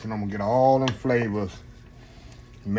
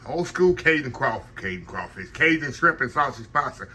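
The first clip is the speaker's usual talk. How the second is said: ordinary talk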